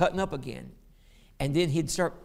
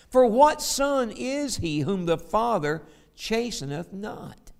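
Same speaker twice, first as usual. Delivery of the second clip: raised, ringing reading of the verses; pulpit scripture reading; 4 dB louder than usual. Acted. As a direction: proclaim the scripture to the congregation. Preacher reads Hebrews aloud.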